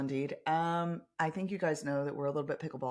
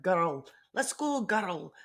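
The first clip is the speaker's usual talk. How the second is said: slow, louder hammed-up Scottish accent; strong Scottish brogue; 4 semitones higher, 5 dB louder, much slower than usual. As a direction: lay on a thick Scottish brogue. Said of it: "strong brogues that it was like".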